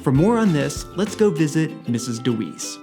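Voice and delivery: usual speaking voice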